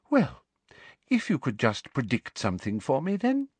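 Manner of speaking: sugary high-pitched official woman; sweet, prim and very high; prim high Umbridge voice. Acted. Umbridge asks for prediction.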